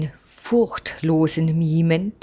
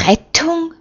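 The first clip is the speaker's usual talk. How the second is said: Rettung? sung out much higher as a mocking query; mocking fluted question; 10 semitones higher than usual. A narrator's reading. Troublemaker mockingly pipes to his neighbour, fluted Rettung?. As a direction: flute Rettung? up high to needle him. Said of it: "wagt Hilkia ... zuzuflöten".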